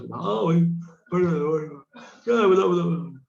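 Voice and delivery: mock lazy armchair know-it-all; armchair philosopher. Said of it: "ना कि arm chair philosopher". (आराम-कुर्सी वाले दार्शनिक की नकल)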